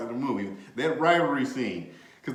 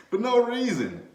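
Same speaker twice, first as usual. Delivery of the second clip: normal talking voice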